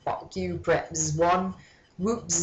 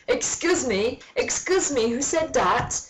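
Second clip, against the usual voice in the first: high, raised indignant janitor; mock yell; 7 semitones higher than usual. A narrator's reading. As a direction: demand it like an outraged janitor. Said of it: "yelled Mister Norris".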